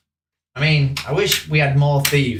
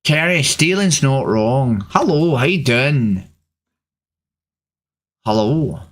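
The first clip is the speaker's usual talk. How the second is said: put-on Northern Irish accent; 3 semitones lower than usual. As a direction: put on a broad Belfast accent. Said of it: "I can't do the Northern Irish accent".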